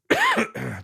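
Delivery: sharp cough. Real, not acted. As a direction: cough once, hard. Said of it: "sorry about the coughing".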